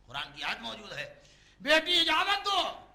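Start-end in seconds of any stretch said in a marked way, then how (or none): 1.6-2.9 high, loud, drawn-out appeal; urgent plea. Acted. Father urging daughter to let the visitor in, slow loud plea. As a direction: stretch the appeal out slowly and loudly.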